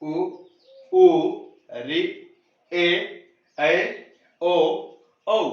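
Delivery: each vowel sounded out alone with a pause after; slow classroom recital of the vowel list; teacher sounding out vowels for pupils; reciting the vowels. Shown as a demonstration. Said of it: "एक बार फिर से इनका उच्चारण कर लेते हैं".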